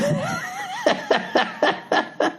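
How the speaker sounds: long, high, wheezing laugh; helpless giggles in a string; giggling fit. Real, not acted. Comedian cracking up before reading, high laughter. Cracks up while starting to read.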